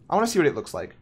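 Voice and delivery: ordinary delivery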